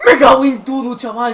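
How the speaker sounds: angry outburst. Real, not acted. Frustrated at missed chance.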